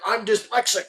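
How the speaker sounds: high-pitched, defensive mock protest; exasperated excuse. Golfer excusing his wrong turn to the clubhouse, high exasperated protest. Acted escalating clubhouse argument retold.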